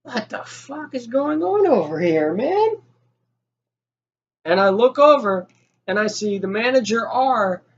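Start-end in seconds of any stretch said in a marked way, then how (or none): 0.0-2.8 high, slow, disbelieving; mock bewildered thought; alarmed inner thought. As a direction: voice climbs in shock. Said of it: "I'm like".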